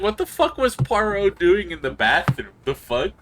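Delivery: loud, high baffled outburst; outraged yell. Retold or imitated. Player mocking the game's bathroom scene, loud baffled yell. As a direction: yell it in disbelief at Poirot. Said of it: "I'm like".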